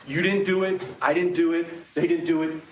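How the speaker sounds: ordinary delivery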